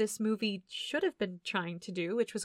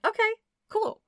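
upbeat okay; 11 semitones higher, 3 dB louder than usual. Retold or imitated. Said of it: "I was like".

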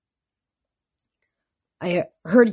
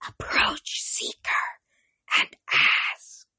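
eerie whispered hiss, slow; hissing oracle; 3 dB quieter, much slower than usual. A narrator's reading. Ancient oracle speaking in minds, ghostly whisper. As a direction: breathe it like a dead spirit. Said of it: "her voice hissed".

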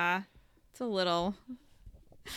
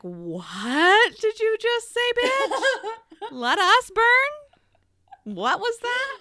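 much higher, louder, very slow outraged demand; furious Charlotte; 12 semitones higher, 6 dB louder, much slower than usual. Acted. Charlotte bursting in on Tessa, high slow outrage. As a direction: drag it out in scandalized fury. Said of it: "And Charlotte is like".

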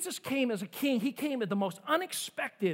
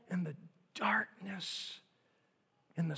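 breathy hushed whisper; whispered for weight; whispered darkness; 4 semitones lower, 8 dB quieter than usual. Real, not acted. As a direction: let the word darkness sink to a whisper. Sermon climax on evil.

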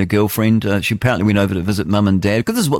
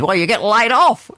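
mocking punchline; 12 semitones higher than usual.

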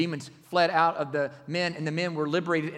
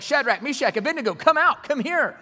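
high, loud calling out of the fire; excited calling out; 9 semitones higher, 13 dB louder than usual. Retold or imitated. King calling men from the furnace, loud high shout. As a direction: shout them out in amazement. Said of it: "his whole tune changed".

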